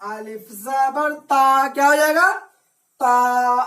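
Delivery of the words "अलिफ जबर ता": teacher spelling out letters slowly. Shown as a demonstration. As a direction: spell अलिफ जबर ता slowly, drawing out the ता. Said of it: "इसी को कहते हैं अलिफ मद्दा".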